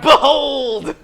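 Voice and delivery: drawn-out, high, full-voiced shout of BEHOLD. Shown as a demonstration. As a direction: shout it like a rallying cry. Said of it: "you gotta shout".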